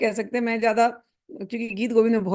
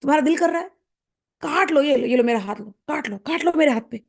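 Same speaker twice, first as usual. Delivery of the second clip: eager offering; 5 semitones higher, faster than usual. Acted. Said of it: "फिर कहते हैं".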